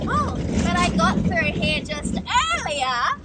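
mock sheep voice; voicing a sheep. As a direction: give the sheep's thought in a silly voice. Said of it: "They're all wondering".

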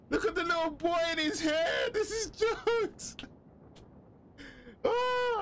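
very high-pitched, delighted squeal; high falsetto outburst of amusement; excited squeal. Real, not acted. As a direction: squeal it way up high, stretching the last words. Gamer mocks a photo, delighted.

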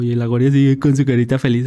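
normal talking voice

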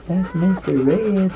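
slow, high puppy voice; high slow pet voice. Real, not acted. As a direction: coo it slowly and high.

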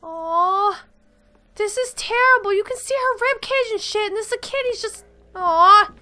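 pitying aw. Real, not acted. Gamer pitying a starving child, voice lifted and pained.

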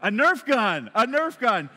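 loud, high exclamation, falling at the end; big playful outburst; mock amazement. Real, not acted. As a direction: exclaim it in amused disbelief to the crowd. Repeats boy's answer to crowd.

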